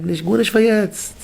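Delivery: slow, high-pitched emphatic quote; high drawn-out disapproval. Acted. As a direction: stretch it out, pitched well up. Acting out a negative verdict.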